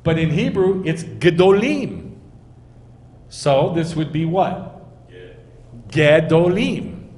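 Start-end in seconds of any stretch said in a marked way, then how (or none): 1.0-2.2 "gedolim" with a short first vowel and punched "-lim"; eh in first syllable. Shown as a demonstration. Teacher giving the Hebrew plural, "gedolim" with short first vowel. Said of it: "it should be an eh". 5.9-7.1 slow, loud "Gedolim", a clear "geh" first; eh in first syllable. Shown as a demonstration. Teacher answering what this would be, sounded-out "Gedolim" with eh. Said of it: "it should be an eh".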